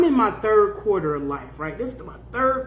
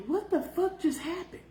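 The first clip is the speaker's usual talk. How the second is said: dazed disbelief; 8 semitones higher, 8 dB quieter than usual. Retold or imitated.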